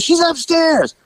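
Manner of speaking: put-on high, urgent girl voice; frantic girl. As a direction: blurt it out anxiously. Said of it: "She goes".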